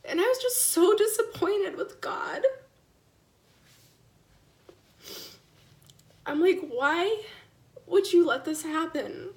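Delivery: crying out at God. Real, not acted. Mother cries out her anger at God to viewers, high and tearful. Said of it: "a little bit of a cry fest".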